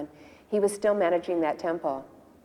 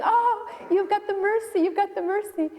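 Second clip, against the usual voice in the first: high, louder joyful exclamation; ecstatic; 3 dB louder than usual. Retold or imitated. Guru greeting his disciple back home, imitated ecstasy. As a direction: cry it out overjoyed, pitch way up. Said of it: "jumping up and down in ecstasy".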